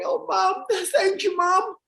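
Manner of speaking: high-pitched tearful son, hammed up; fake-cry your thanks in a high voice; imitating a grateful son in tears; mock crying. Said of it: "So Joe starts crying".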